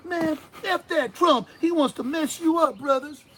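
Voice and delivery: high, loud, slow hammed-up rapper drawl; loud mocking caricature of a rapper on TV. Host mocking a rapper's TV persona, loud slow caricature. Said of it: "this is the guy that's on T V going".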